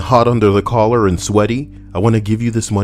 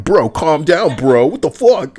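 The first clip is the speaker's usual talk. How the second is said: higher, loud exasperated voice; arguing with himself; 8 semitones higher, 3 dB louder than usual. Retold or imitated. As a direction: push back at yourself, higher and heated.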